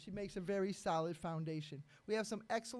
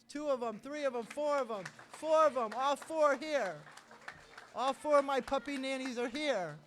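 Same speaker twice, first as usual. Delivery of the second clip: excited; 5 semitones higher, 9 dB louder than usual. Real, not acted. Excitedly counting puppy nannies.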